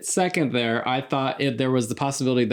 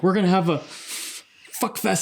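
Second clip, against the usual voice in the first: higher, hesitant, with a hold before FUCK FEST; reluctant stutter; 6 semitones higher than usual. Retold or imitated. Principal warning the sheriff, reluctant and halting. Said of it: "But he stutters. He doesn't wanna say it".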